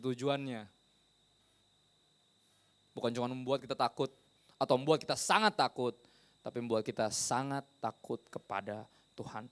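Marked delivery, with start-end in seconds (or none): 4.6-5.9 SANGAT punched louder; stress on SANGAT. Real, not acted. Preacher building degrees of fear, SANGAT stressed loudly. 6.4-9.5 slow, weighty delivery with SANGAT drawn out; slow emphasis. Real, not acted. Preacher completing the progression, slow and weighty. Building up degrees of fear.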